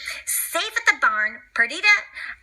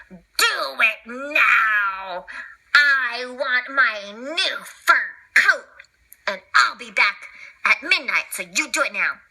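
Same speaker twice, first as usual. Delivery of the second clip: villainess; 2 semitones lower, slower than usual. Acted. Cruella orders the crooks.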